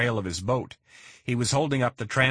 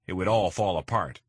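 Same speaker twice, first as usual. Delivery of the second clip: usual speaking voice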